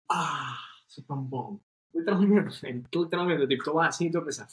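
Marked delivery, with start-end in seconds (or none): 0.1-1.6 slow, soft, low, self-satisfied; smug contented sigh. Retold or imitated. Imitating himself in the jacuzzi waterfall, slow smug sigh. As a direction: sigh it out like total bliss.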